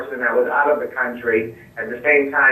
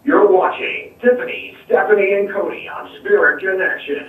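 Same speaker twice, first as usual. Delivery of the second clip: announcer voice on request; 8 semitones higher than usual. Pretend. Guest announcing the show for the hosts, playful announcer voice. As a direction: announce the show like a radio station ID. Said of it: "say Spirit Connections in that voice".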